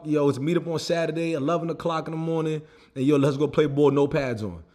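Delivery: normal talking voice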